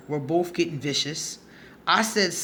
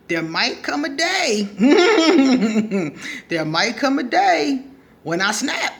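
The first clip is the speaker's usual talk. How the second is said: laughing warning; 7 semitones higher, 6 dB louder than usual. Real, not acted. Speaker warning what happens when pushed too far, laughing. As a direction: crack up midway through the threat.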